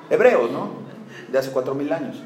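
ordinary talk, nothing marked

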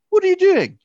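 high, loud mock scolding; callous. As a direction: shout it at her, exasperated and unsympathetic. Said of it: "a little callous".

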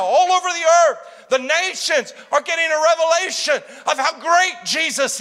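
loud, high proclamation, shouting out Jesus; preaching shout. Real, not acted. Preacher announcing revival to the congregation, shouting. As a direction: cry it out over the crowd.

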